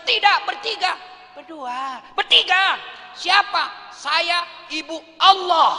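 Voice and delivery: high, loud insistent no; high loud protest; shouted refusal. Acted. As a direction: shout each refusal back at her. Yusuf refusing, arguing.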